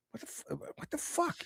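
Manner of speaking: hushed, breathless what-the; whispered disbelief. Real, not acted.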